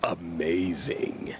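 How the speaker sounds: slow low murmur; low and drawn out. Pretend. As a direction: sink deep and stretch the word. Villain bit after evil laugh.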